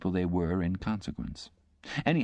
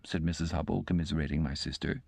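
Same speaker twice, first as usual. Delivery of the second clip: ordinary delivery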